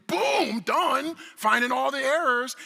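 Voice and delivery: loud, high burst of showman's excitement; mock triumph. Presenter parodying his own easy demo to the audience, mock triumph. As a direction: punch it out like an instant success. Mocking a demo's easy success.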